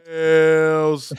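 loud, long-held yell; drawn-out joke holler; yelled team name. Shown as a demonstration. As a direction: draw the name out into the microphone. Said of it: "we just yell Bills into the microphone".

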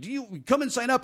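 high mock salesman question; lightly ape the pushy promoter; timeshare hawker. Mocking the guy who stopped him, high salesman voice. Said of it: "He was like".

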